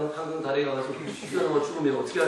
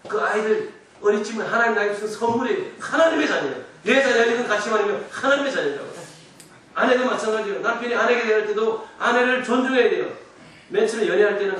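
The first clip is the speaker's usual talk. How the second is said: raised, emphatic sermon voice; forceful preaching; 5 semitones higher, 7 dB louder than usual. Real, not acted. Pastor urging husbands to honour family, forceful preaching. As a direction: press the point home, high and loud.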